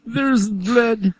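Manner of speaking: put-on hammy German accent. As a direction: say it like a German scientist. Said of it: "think of the German accent".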